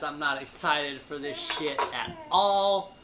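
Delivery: normal talking voice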